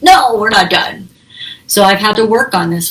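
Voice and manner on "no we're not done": slow, firm mock insistence, a little louder; mock stubborn demand. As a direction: drag it out like refusing to let the fight end. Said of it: "when I get in that fighting mode".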